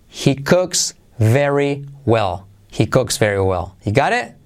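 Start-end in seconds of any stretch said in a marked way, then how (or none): 0.1-2.5 "He cooks very well" word by word; slow, separated teaching delivery; slow model phrase. Shown as a demonstration. Teacher models slowly, then linked. 2.7-3.8 "He cooks very well" run together, lower pitch; connected, lower-pitched model sentence; linked natural phrase. Shown as a demonstration. Teacher models slowly, then linked.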